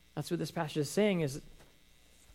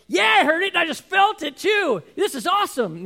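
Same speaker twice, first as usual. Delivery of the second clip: loud, high thrilled yell over the movie; excited shout; 13 semitones higher, 9 dB louder than usual. Retold or imitated. Husband yelling happily to his wife over the movie noise.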